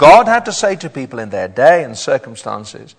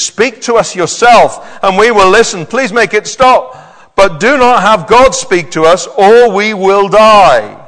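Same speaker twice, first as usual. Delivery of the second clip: a touch of mock terror, higher and slowed; terrified pleading; 6 semitones higher, 7 dB louder than usual. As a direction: beg Moses, imitating frightened people. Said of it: "it was utterly terrifying".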